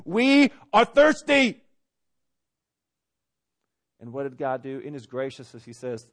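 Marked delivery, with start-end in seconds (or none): 0.1-1.6 loud, high, drawn-out mock tantrum. Preacher mimics the thirsty people complaining, mock tantrum. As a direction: whine it out like a child throwing a tantrum. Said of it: "threw a tantrum and said".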